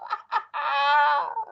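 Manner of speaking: very high witchy cackle; gleeful cackle. A narrator's reading. Wife delighted by her trick, high cackle. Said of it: "cackled Missus Twit".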